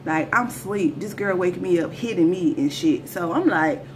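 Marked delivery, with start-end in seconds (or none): none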